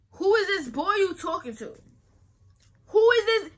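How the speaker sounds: loud, high, furious fatherly yell; angry dad yelling. Retold or imitated. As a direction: shout like a dad who just caught you. Dad confronting her about texts.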